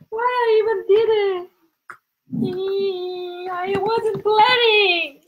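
high, drawn-out whine; comic wailing regret. Real, not acted. Host moaning over her unplanned live, high drawn-out wail. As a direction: stretch it into a high helpless wail.

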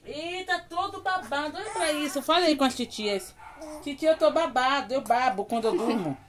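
put-on high cooing baby voice; baby talk. Mother voicing her newborn to viewers, high cooing. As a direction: coo high and sweet as if the baby speaks.

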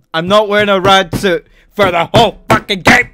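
loud, high-pitched angry yell; furious gamer shouting a refusal; exasperated outburst. Real, not acted. Ranting about the game.

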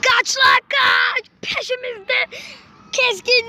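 high, slow urgent cry; panicked. Real, not acted. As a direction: urge them to run. Fleeing the monster.

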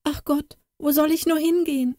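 high, quick plaintive wail; lamenting. Acted. Katrin at the brook seeing her ugly face, high lament. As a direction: cry out in despair. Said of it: "klagte sie".

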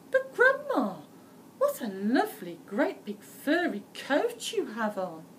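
put-on high, loud child's voice on Grandma; girl admiring the coat innocently; little girl voice. A narrator's reading.

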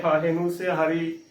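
normal talking voice

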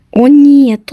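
usual speaking voice

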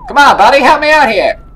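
very high, shouted cartoon plea; exaggerated cartoon begging at full volume; Terrence and Phillip voice. Shown as a demonstration. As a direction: yell it in a squawky comic voice. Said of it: "give him the Terrence and Phillip voice".

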